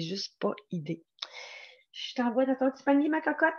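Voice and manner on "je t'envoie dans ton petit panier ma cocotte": high, quick coaxing voice; pet talk. Real, not acted. Host sending pet to basket, coaxing. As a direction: send the pet off in a high, doting voice.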